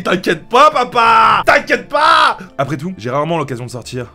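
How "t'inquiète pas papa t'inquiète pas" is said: loud, high call to papa; shouted reassurance. Acted. Heroine shouting to her dad on the way out. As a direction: yell it over your shoulder.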